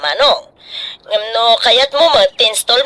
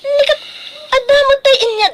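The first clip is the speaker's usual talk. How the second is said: indignant; 13 semitones higher than usual. Acted. Argument over payment continues.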